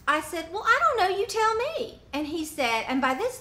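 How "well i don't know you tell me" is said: sharp, high, louder retort, fed up; exasperated retort. Retold or imitated. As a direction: toss it back at him, clearly irritated. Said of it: "I am getting upset".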